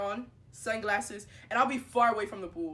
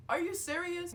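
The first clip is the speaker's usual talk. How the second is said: high-pitched incredulous question; mock disbelief; 9 semitones higher than usual. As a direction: exclaim it in high mock amazement. Acted disbelief.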